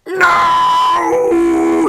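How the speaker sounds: very high, loud shrieked refusal; long wailing scream of refusal; terrified shriek. A narrator's reading. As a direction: shriek it in sheer panic. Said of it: "shrieked Henry".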